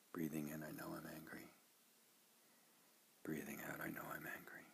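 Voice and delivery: very soft, inward recitation; quiet meditation phrases with long pauses; guided meditation. Shown as a demonstration. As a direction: say it hushed, as if to yourself.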